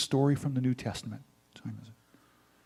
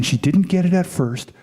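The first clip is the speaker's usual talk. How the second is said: ordinary delivery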